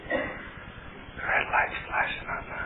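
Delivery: slow hushed inner voice; whisper. Retold or imitated. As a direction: whisper it slowly like a tempting thought. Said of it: "the little voice goes".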